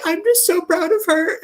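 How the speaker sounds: high, tear-choked voice; retold teary pride. Castmate Kira sobbing in the wings, high and teary. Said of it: "And she's like".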